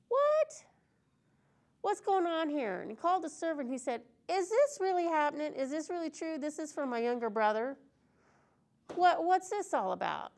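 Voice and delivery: high, loud disbelief; shocked older brother. Acted. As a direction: react like you can't believe it. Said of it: "And he's like".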